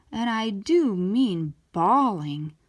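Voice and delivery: ordinary delivery, nothing marked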